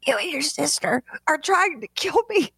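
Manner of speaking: exasperated scolding. Retold or imitated. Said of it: "I'm like".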